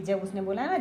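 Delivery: ordinary delivery, nothing marked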